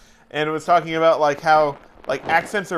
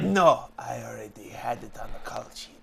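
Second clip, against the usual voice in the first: put-on hushed, low French-Canadian accent; 4 semitones lower, 4 dB quieter than usual. Trainer answering about a girl's number, hushed French-Canadian accent. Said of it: "slight, slight French accent".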